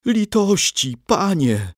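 high, pleading whimper for mercy; a cornered man's high, frightened plea; moaning plea. Acted. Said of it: "jęknął smalec".

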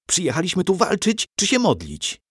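high-pitched impatient question; impatient boy. A narrator's reading. Eager son fretting before the battle, impatient and high. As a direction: demand it like a restless youngster. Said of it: "niecierpliwił się Andrzej".

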